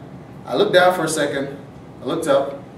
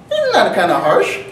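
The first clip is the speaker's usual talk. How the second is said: seagull taking exception; 5 semitones higher, 6 dB louder than usual. Acted. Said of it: "He's like".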